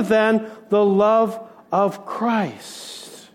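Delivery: love of Christ drawn out very slowly; slowed climax. Acted. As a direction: stretch each word of love of Christ. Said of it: "can define me other".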